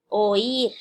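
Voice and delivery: "Oír" drawn out as o-ír, stress on the í; syllable separation. Shown as a demonstration. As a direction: show the hiatus in "Oír" by stretching both vowels. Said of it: "aquí nuevamente hay una separación". ("Oír" separado en sílabas, o-ír, con la í acentuada)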